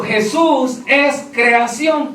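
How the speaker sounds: each word slowed and weighed out; slow quoted doctrine. Retold or imitated. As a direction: lay the doctrine out slowly, word by word. Quoting the false doctrine. (cita lenta y pesada de la doctrina)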